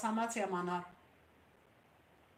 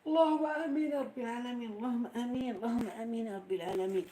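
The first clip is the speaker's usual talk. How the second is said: prayerful amen; 2 semitones higher than usual. Real, not acted. Prayer response.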